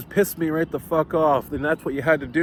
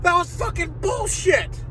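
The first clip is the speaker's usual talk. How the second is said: much higher, shrill-timbred mock outrage; squeaky outraged rant. Cheated kid rants about the fake competition, high and outraged. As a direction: rant in a strained falsetto fury. Ranting, far higher shouted voice.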